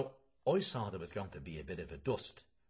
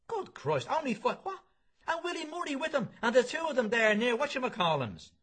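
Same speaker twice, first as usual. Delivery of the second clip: flabbergasted; 13 semitones higher than usual. Acted. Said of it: "he was what you might call flabbergasted".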